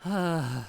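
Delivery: loud exasperated huff; exasperated groan. Real, not acted. Streamer fed up before resuming the rant, loud exasperated groan. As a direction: heave out a loud, fed-up ugh.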